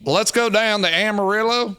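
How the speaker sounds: a playful put-on Texas accent; Texan accent. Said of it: "that was not Jamaican at all".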